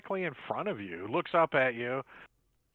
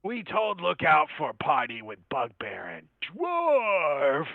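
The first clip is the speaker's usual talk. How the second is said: slow, broken goblin speech; 5 dB louder, much slower than usual. Acted. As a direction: talk like a dim goblin. GM voices goblin lookout order.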